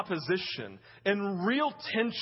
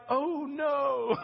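mock-dismayed, higher "oh no"; dismayed wife; 4 semitones higher than usual. Preacher voicing Abram's wife hearing they must move, mock dismay. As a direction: play the wife's sinking realisation. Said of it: "she's like".

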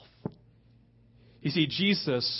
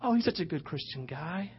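higher, softer mock-admiring voice; admiring church folk; 3 semitones higher, 6 dB quieter than usual. Churchgoers sizing up the rich young ruler, softly admiring. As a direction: gush softly, as an impressed onlooker would. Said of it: "we'd all go".

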